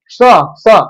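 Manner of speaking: स as a bare consonant sound, twice; isolated letter sound. Shown as a demonstration. Teacher showing pupils the consonant sound, isolated and repeated. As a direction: sound out स alone, separately each time. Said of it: "वो आ रही है स की".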